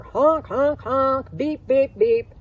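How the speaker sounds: loud, high honking and beeping; loud, high toy-horn honks; imitated car horns.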